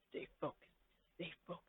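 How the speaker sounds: soft, breathy whisper to yourself; whispered to self. Acted. The listener calming themselves in a whisper. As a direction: whisper it under your breath.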